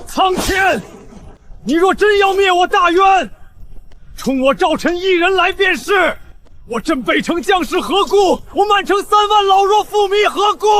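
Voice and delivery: high, desperate shout; crying out to heaven. Acted.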